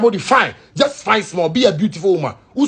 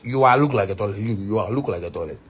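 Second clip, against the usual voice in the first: fading mutter; 9 semitones lower, 5 dB quieter than usual. Acted. Fading taunt, quieter.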